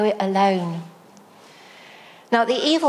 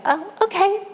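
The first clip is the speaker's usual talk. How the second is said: mock bright acceptance; 10 semitones higher than usual. Politely swallowing annoyance at party.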